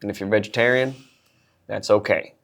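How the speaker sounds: deadpan Southern sergeant introducing himself; Southern accent. Acted. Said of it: "a little bit of Southern accent".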